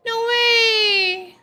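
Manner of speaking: ordinary delivery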